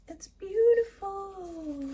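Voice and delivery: high, slow, teary awe; overjoyed. Real, not acted. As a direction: gush over it, almost crying. Final reveal of her bread.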